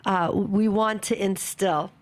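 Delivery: usual speaking voice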